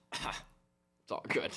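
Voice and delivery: hushed, slow words after a coughing fit; strained throat after coughing. Real, not acted. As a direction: rasp it out, throat still sore. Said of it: "a popcorn kernel stuck in my throat".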